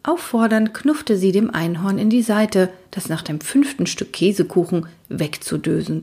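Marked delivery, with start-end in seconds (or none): none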